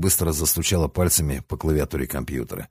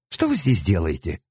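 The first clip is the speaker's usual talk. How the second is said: put-on thin girlish voice. A narrator's reading. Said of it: "раздался позади тонкий девичий голосок".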